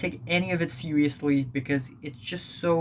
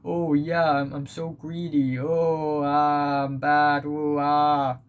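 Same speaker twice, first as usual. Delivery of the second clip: hammy mock villain; slower than usual. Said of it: "that are just so".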